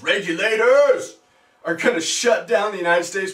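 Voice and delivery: sarcastic emphasis. Retold or imitated. Sarcastic echo of his claim.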